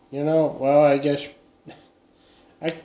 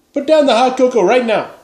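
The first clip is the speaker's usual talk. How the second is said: higher, louder hammed-up police order; mock command; 9 semitones higher, 7 dB louder than usual. Facing a neighbour holding cocoa, mock yelled order. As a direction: bark it like a cop at gunpoint. Gunpoint standoff over cocoa.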